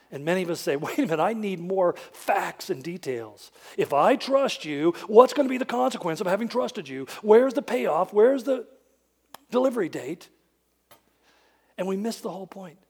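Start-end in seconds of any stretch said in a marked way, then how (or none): 0.8-10.3 insistent, rising louder questions; demanding details. Retold or imitated. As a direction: push for the payoff. Worried believer demanding details.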